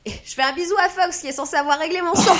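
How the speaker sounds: loud, fast and high for the mic; speaking loudly. Shown as a demonstration. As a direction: talk up so everyone hears you. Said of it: "je vais parler très fort".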